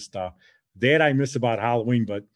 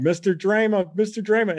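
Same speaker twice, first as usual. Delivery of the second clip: mock yell; 8 semitones higher than usual. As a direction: imitate yelling a name through a door.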